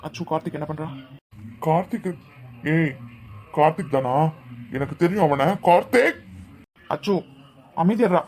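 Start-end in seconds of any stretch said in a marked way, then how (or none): 1.7-6.2 loud, heated outburst rising to a yell; angry shouting. Acted. Friend blaming Karthik for the tears, angry shouting. 6.9-8.2 loud, higher-pitched order to calm down; forceful hushing. Acted. Trying to stop a friend's outburst, forceful hushing.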